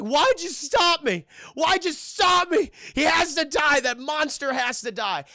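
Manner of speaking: loud, high acted wail of protest; imitating a distraught man yelling; tearful anguished shouting. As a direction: cry out in hammed-up anguish. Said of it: "he's crying".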